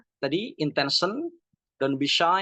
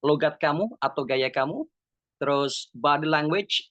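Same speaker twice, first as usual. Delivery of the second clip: ordinary talk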